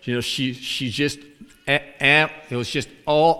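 ordinary talk, nothing marked

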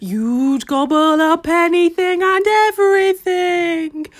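put-on slow, drawn-out older woman's voice; a slow, knowing farmer woman; Missus Jingle Jangle's voice. A narrator's reading. Remembered farmer woman teasing the pig, slow and knowing. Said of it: "it says".

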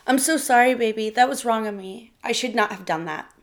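pleading with his wife. A narrator's reading. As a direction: beg her forgiveness, voice climbing. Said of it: "Dennis pleaded with Sarah".

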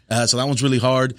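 ordinary delivery

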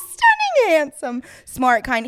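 loud laughing praise; gushing hype shout. Acted.

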